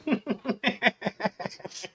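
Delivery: quiet wheezing laugh; a real laugh under the breath; laughing at the punchline; soft laugh. Real, not acted.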